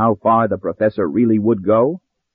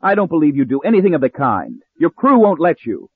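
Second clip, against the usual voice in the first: rising higher in protest; defiant cry; cried out in disbelief; 6 semitones higher than usual. A narrator's reading. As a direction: push back with mounting pitch. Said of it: "she cried".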